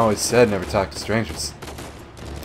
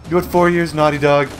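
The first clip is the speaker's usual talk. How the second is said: louder, reproachful call-out of Naughty Dog; mock scolding; 4 semitones higher, 4 dB louder than usual. Real, not acted. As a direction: tease the studio as if telling them off. Scolding the game studio.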